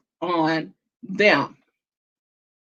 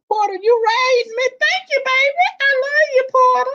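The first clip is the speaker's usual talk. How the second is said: squealing thanks. Real, not acted. Thanking a raider on live stream.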